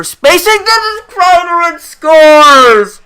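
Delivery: loud, high rising call on the score; sudden goal call. Real, not acted. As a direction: jump on the goal as it happens.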